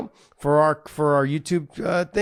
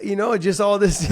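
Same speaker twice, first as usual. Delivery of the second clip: ordinary delivery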